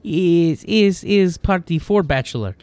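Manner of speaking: put-on heavy accent with broken English; foreign accent. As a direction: play the blunt foreigner with a thick accent. GM voices Broden in broken English.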